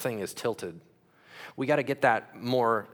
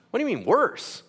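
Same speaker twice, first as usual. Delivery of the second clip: normal talking voice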